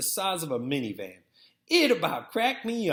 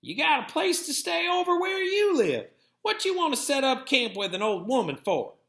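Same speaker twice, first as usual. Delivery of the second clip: Granny; 11 semitones higher, 4 dB louder than usual. A narrator's reading. Grandmother settles the boy as he slams in, much higher voice.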